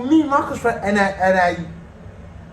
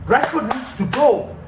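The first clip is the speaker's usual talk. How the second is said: usual speaking voice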